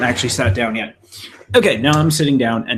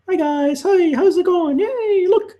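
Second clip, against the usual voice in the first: much higher giggly cartoon voice; squeaky little mascot greeting the viewers; chirpy cartoon pointer character, laughing; Mister Cursor Man. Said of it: "it's me, Mister Cursor Man".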